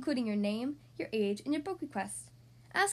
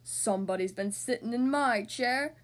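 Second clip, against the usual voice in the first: gruff papa bear voice; big storybook bear growl; Daddy Bear roar; 3 dB louder than usual. A narrator's reading. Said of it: "roared Daddy Bear".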